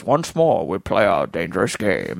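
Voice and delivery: low, weighty speech-making voice; low-pitched, measured movie-star impression; Sean Connery. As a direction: drop deep and intone it like a grand captain's address. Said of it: "you know, Sean Connery speeches".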